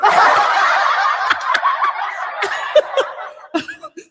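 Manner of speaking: loud, very high cackle of real laughter; a real, high-pitched cackle; helpless high laughter; high burst of laughter. Real, not acted. Laughing at husband's sneak attack.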